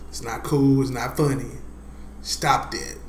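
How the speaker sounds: ordinary talk, nothing marked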